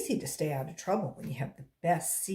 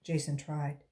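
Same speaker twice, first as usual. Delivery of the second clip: ordinary delivery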